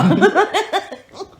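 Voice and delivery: a burst of high laughter; loud high laugh. Real, not acted. Laughing at the story.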